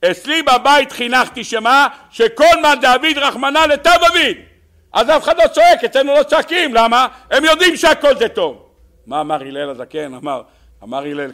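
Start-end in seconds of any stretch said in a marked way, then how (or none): 0.1-8.6 very high, loud, fervent proclamation; emphatic declaring. Acted. Hillel explaining why nobody screams at home, emphatic declaring. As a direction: proclaim it at full force.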